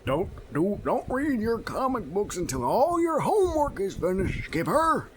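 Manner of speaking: high, loud mock parent scolding; nagging dad. Dad scolds Skipper about comics, hammed-up high nagging. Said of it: "His dad's like".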